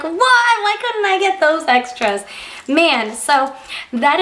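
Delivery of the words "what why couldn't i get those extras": high, slow, outraged whine; indignant disbelief. Retold or imitated. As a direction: act out being cheated out of extras. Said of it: "and I was like".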